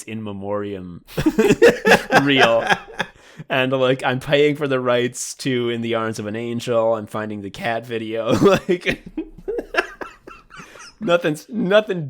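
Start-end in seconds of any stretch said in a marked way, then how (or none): 1.1-2.2 burst of laughter. Real, not acted. Laughing at own joke. 9.1-11.0 high squealing laugh. Real, not acted. Laughing at own joke.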